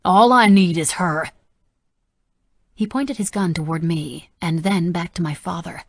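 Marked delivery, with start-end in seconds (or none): 0.0-1.3 loud demand. Acted. Gunman continues screaming.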